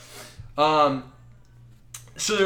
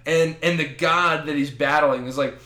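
normal talking voice

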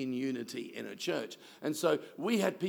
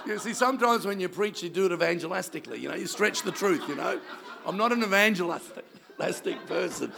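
louder, higher, laughing through the words; laughing aside; 4 semitones higher, 5 dB louder, faster than usual. Real, not acted. Pastor teasing preachers who stretch the truth, laughing.

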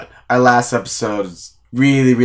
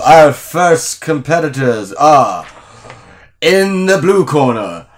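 slow, drawn-out fight announcer; ring announcer; 2 semitones higher, 3 dB louder, slower than usual. Acted. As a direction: stretch each name like a ring announcer. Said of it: "Mister Announcer".

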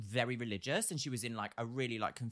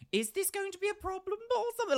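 worried thought. Retold or imitated. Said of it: "I was a bit like".

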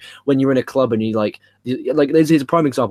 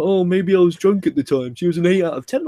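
mocking quote voice; 6 semitones higher than usual. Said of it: "She's like".

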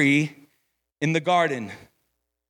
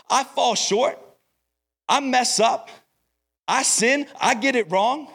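rising preaching; 5 semitones higher than usual. Acted. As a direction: push the confession to the congregation, with growing fervour. Preaching builds up.

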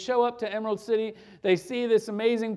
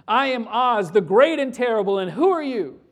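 booming wizard; 7 dB louder than usual. Acted. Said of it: "He says".

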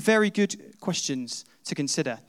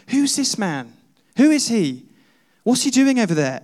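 higher, louder, curious questions; puzzled questioning; 6 semitones higher, 5 dB louder than usual. Acted. Onlooker asks a friend about the wild man, puzzled. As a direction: ask with rising urgency, as an onlooker.

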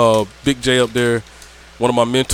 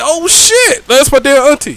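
shocked realization; 13 semitones higher, 4 dB louder, slower than usual. Retold or imitated.

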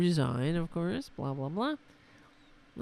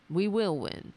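ordinary talk, nothing marked

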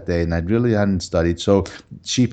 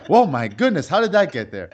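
feigned surprise; 6 semitones higher than usual. Retold or imitated. Student caught cheating plays innocent to teacher, feigned surprise. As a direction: act amazed at your own evidence.

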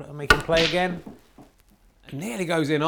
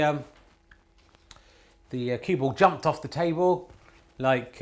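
normal talking voice

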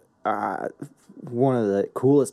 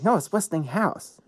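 higher, slow correcting voice; Lucy; 6 semitones higher than usual. Retold or imitated. Lucy correcting Desi's pronunciation, higher imitated voice. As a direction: imitate her correcting him, drawn out and higher. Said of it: "And Lucy's like".

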